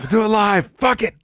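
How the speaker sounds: high-pitched mock tantrum; strained, high imitation yell; Bill O'Reilly outburst. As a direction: imitate a fed-up TV host erupting. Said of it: "what was the Bill O'Reilly one".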